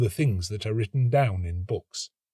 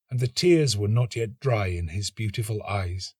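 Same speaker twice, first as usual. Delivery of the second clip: ordinary delivery, nothing marked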